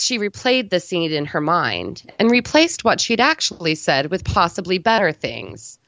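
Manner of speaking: usual speaking voice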